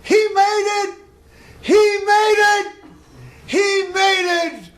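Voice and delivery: very high, loud, slow screams; screaming. Retold or imitated. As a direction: scream it to the mountain top with everything. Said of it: "I screamed on the top of my lungs".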